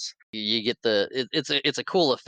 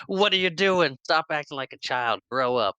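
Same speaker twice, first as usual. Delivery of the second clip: mock angry scolding; 6 semitones higher than usual. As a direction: snap at the kids like a fed-up father. [name] scolding his kids.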